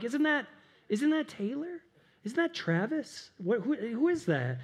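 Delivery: high, hushed celebrity-spotting question; starstruck wondering. Acted. Fan spotting someone famous at the airport, starstruck wondering. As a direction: play a fan doing a double take.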